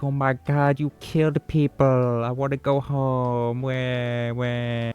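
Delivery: high, slow, whimpering mock whine; mock her wanting to go home, fake crying; whiny mock girl. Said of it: "She's all like".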